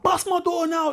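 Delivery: high, pushy demand to open up; mock demand. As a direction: shout it up high, half-mocking. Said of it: "He's like".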